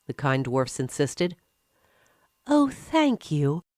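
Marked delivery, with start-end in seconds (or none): 2.5-3.6 put-on grateful girl. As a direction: thank them gently, a young girl's voice.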